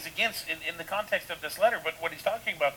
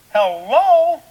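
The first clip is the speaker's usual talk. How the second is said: loud, high, drawn-out incredulous "hello?"; exasperated, sky-high "hello?"; 10 semitones higher, 16 dB louder than usual. Real, not acted. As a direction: call it out like it's glaringly obvious. Mocking incredulous Hello at absurd idea.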